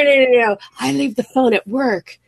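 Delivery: ordinary delivery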